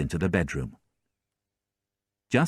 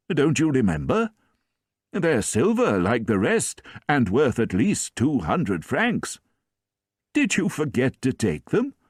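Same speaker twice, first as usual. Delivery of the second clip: put-on higher, gently chiding old bishop; kindly bishop; 7 semitones higher than usual. A narrator's reading. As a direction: remind him kindly, as if puzzled.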